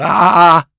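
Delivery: three short aching groans; sciatica groans. Retold or imitated. Imitating the moans coming off the boat, aching groans. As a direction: groan like your back's out. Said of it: "Like you've pulled your sciatica".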